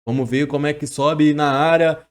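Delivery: ordinary delivery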